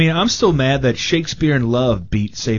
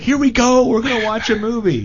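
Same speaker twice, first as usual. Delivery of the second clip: excited announcer; 8 semitones higher than usual. Retold or imitated. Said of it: "It's like".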